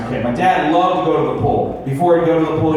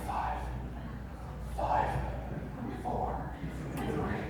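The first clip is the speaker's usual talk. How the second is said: much softer, unvoiced grumbling dad; muttering under breath; 7 semitones higher, 17 dB quieter than usual. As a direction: mutter it to yourself, barely audible. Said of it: "muttering under his breath".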